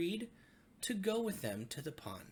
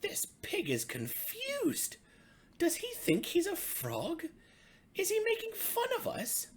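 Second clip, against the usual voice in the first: puzzled frogs; 5 dB louder than usual. A narrator's reading. Frogs puzzling over the pig, high and indignant. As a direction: wonder aloud, a bit offended.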